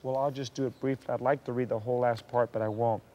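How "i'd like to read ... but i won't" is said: ordinary talk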